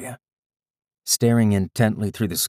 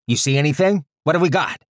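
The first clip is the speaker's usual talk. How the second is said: urgent man voice; 8 semitones higher, 5 dB louder than usual. Acted. Man over comms.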